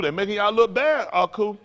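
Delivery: usual speaking voice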